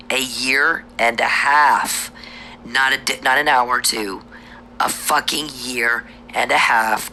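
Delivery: slow angry emphasis on how long; emphatic insistence. Real, not acted. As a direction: drive the point home word by word. Insists on therapy duration.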